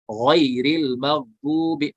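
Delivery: गैरिल मगदूबी read slowly and gently; slow recitation. Shown as a demonstration. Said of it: "या लीन है".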